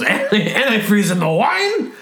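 high, incredulous officer; shocked policeman. Acted. Police officer hearing of antifreeze in wine, mock shocked. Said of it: "And the police goes".

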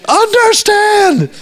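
high, drawn-out mock yell; hammed-up shout, stretched long; yelling at students. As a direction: holler it as a useless instruction. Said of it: "yelling "understand" at his students".

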